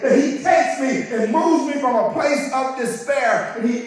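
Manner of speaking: loud, high preacher's cry; full-force sermon shout; fiery preaching. Acted. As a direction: preach it out to the congregation.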